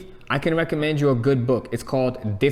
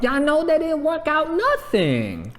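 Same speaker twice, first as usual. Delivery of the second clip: exasperated disbelief; 12 semitones higher, slower than usual. Real, not acted. Exasperated rant about the experts.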